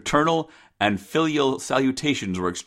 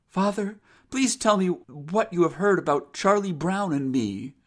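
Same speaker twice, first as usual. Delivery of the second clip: higher, earnest daughter's voice; serious daughter; 8 semitones higher than usual. A narrator's reading. Rose questions her father about Charlie, high and serious. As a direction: ask earnestly, pitched up. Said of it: "she said very seriously".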